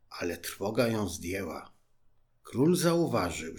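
usual speaking voice

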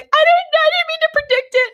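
imitated squeaky, flustered excuse; high-pitched apology. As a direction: re-enact a friend apologizing way up high. Acted apology from friend, very high.

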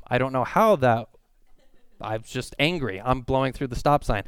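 normal talking voice

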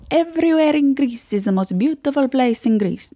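high, proud Greek uncle with Italian lilt; Greek-accented uncle drifting into Italian; Greek accent, too Italian. Retold or imitated. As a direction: boast in a botched Greek accent. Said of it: "I made it too Italian".

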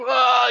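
short high pained yell; anguished scream. Retold or imitated. Man shouting in anguish after killing his friends. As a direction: cries out in horror after shooting them.

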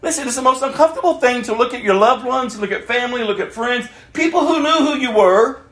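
ordinary delivery

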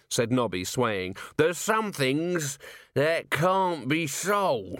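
put-on slow, slurred drunken ranting; drunk Nobby. A narrator's reading. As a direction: drag every word out like a swaying drunk.

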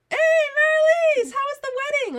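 big sing-out hello, stretched long; loud greeting. Retold or imitated. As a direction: holler a greeting across the room. Calls out greeting in retold scene.

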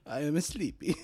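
high put-on sleepy voice; silly high voice. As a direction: play it goofy and high.

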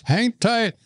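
high, urgent call; called order. Retold or imitated. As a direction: shout it across the cage to the boys. Trainer calls to the boys.

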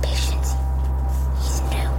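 breathy whispered aside; eerie whisper. Acted. Gardener whispers to the plants about the newcomer. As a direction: whisper as if to unseen listeners.

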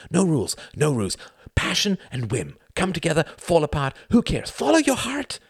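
high-pitched, building manifesto; manic fervour. A narrator's reading. Youngest brother preaches freedom with shaking hands, manic fervour.

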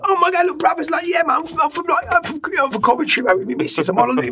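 high-pitched, gushing fan from Coventry; excited fan. Retold or imitated. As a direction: squeal it like a starstruck fan on holiday. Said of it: "you get the odd kind of like".

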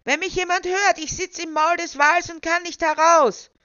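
high, full-voiced desperate shout; shouting for help. A narrator's reading. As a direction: call out as loud as you can to be heard. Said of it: "schrie er so laut er konnte".

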